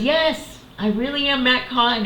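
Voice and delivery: high, emphatic mock reassurance; playful insistence, retold. Teacher confirming identity to stunned fan, playful insistence. Acting out the idol's amused reply.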